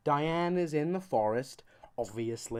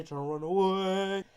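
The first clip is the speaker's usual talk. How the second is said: slow, higher, stretched "run away"; drawn-out refrain; 5 semitones higher, 3 dB louder, slower than usual. Pretend. Comedian repeating Vegeta's run away, long drawn-out finish. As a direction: stretch the last word right out.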